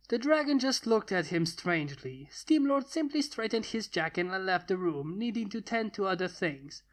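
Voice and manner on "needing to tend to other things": ordinary delivery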